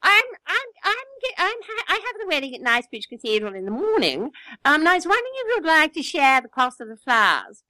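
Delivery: high, lilting upper-class English lady; imitating a posh neighbour bride. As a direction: play the grand society lady asking a favour. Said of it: "She says".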